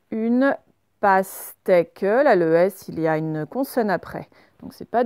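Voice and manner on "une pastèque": slow, drawn-out dictation; dictating syllable by syllable. Shown as a demonstration. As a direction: stretch the word for pupils to write. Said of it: "dictating word to class".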